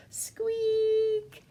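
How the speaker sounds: very high tiny squeak; high mousey squeak; mouse squeak. Shown as a demonstration. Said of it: "teeny tiny mouse up there".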